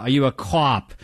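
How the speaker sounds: "cop" with an overdone Boston aw vowel; exaggerated, falsely Boston "cop" as "cawp"; vowel hit too hard. Shown as a demonstration. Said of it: "when they hit that too hard".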